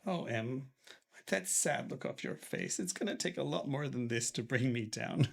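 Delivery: soft, weak, gentle comfort; ailing father comforting his daughter tenderly. Acted. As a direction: reassure her softly from the sickbed.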